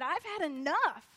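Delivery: loud, slow, fed-up outburst; flipping out. Acted. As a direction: lay down the law as a fed-up king. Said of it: "Oh, he flips out".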